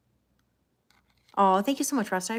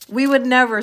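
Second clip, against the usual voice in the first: loud, higher-pitched mock indignation; mock protest; 5 semitones higher, 11 dB louder than usual. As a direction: exclaim it in exaggerated protest. Mock protest reading viewer's comment.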